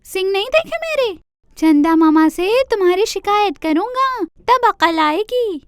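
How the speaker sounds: taunting deer. Acted. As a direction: dare him, cheeky little deer. Deer quarrels with rabbit.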